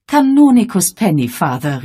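calling out. Acted. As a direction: call him across the lobby. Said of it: "rief Miss Gorringe".

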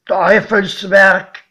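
slow, loud character exclamation; loud condemnation. A narrator's reading. Godfather facing the rails into the mountain, loudly condemning.

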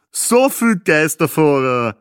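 joking husband. A narrator's reading. Husband jokes about wrong-way drivers, slow and jokey. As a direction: savour the joke slowly. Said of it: "scherzte ihr Mann".

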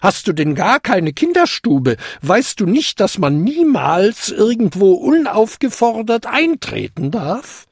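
hohe, empört scheltende alte Dame; entrüstet. A narrator's reading. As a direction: weise den Eindringling empört zurecht. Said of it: "entrüstete sie sich". (entrüstete Standpauke)

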